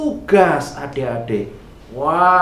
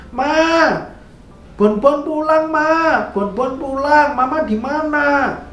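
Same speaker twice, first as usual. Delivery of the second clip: calling out for mama; 8 semitones higher than usual. Acted. Boy searching rooms for mother.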